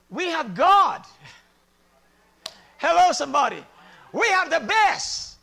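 loud, drawn-out pulpit shout; rally the congregation, loud and slow; triumphant shouted declaration; rousing preaching. Real, not acted. Rouses the congregation.

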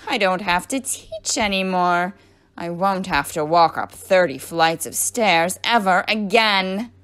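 loud, triumphant relief; very happy. A narrator's reading. Mean teacher done with teaching forever, very happy. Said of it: "Missus Gorf was very happy".

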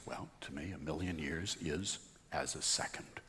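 voice of God. Acted. Joke teller voicing God answering the praying man. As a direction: boom it down a little as God.

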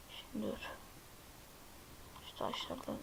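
hushed whisper to yourself; unvoiced sneaking whisper. Real, not acted. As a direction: breathe it out so nothing hears. Sneaking in the game.

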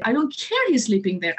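ordinary delivery

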